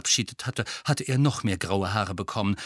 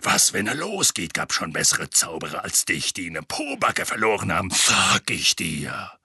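low, growling warning; Mad-Eye Moody; 4 semitones lower than usual. A narrator's reading. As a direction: lecture gruffly, dragging out the last words. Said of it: "donnerte Moody". (tief knurrende, grollende Warnung)

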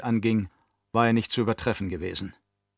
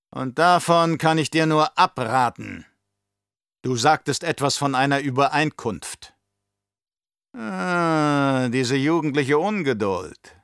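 put-on higher, louder, oily old Baron voice; scheming old Baron; 5 semitones higher, 4 dB louder than usual. Baron to his nephew, raised and sly. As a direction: play the cunning uncle sounding out his nephew.

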